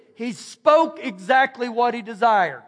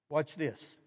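ordinary talk

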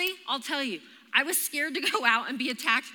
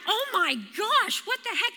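mock screaming; 7 semitones higher than usual. Runner attacked by a bird at dawn, mock screaming. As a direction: retell the fright without really yelling.

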